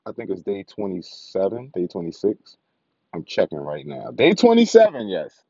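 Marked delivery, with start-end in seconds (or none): 4.1-5.1 hyped anime exclamation; excited shout. Pretend. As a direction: yell it out, pumped. Excited count, anime style.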